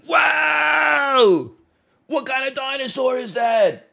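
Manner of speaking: put-on dad cheering on a stranger's babbling child; over-the-top excitement. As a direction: hype the kid up like it's the best thing ever. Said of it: "And I'm like".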